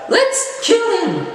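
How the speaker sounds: very high, gleeful-sounding villain squeal; hammed-up high-pitched villain; mock villain girl. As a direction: imitate the bad guy ordering a kill. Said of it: "bring robot to exterminate him. Like".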